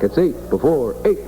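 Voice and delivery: ordinary talk, nothing marked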